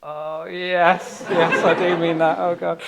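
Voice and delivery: high, laughing embarrassed admission; sheepish mock dismay; laughing realisation. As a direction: catch your own blunder mid-giggle. Realising the rice mistake.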